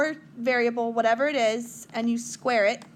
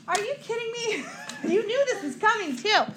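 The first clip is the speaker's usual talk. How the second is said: laughing tease; 9 semitones higher than usual. Real, not acted. Teacher teases a student.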